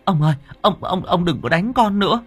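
high-pitched, stammering wife's entreaty; pleading. A narrator's reading. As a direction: implore him urgently in a high voice. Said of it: "Bà Hỏa chụp lại nài nỉ". (nài nỉ khẩn khoản)

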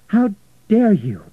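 slow, loud, high rebuke; outraged. A narrator's reading. Florentina rebuking Richard after slapping him, outraged. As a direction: hit each word with outrage.

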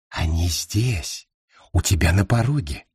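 low, hushed spirit voice; low ghostly whisper. Acted. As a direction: warn him from the shadows.

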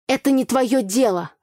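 rude retort. A narrator's reading. Heroine rudely tells Igor to stay out of it. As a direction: cut him off rudely. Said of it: "грубо ответила я".

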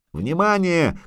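high call for attention; lightly projected, high-pitched announcement; shout from above. A narrator's reading. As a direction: call down to them. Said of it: "крикнул сверху Лютик".

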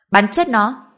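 yelling an order to fire; loud shout. Acted. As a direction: shout it out hard. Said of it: "hắn ta hét to".

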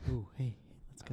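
soft unvoiced whisper; breathy ASMR whisper. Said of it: "Are you gonna say it in A S M R".

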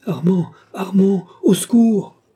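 high, loud scream of danger; shrill cry for help. A narrator's reading. Marthe calling Armand as danger nears, shrill cry. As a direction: cry out shrilly, building to the last words. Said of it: "cria Marthe, de cette voix aiguë".

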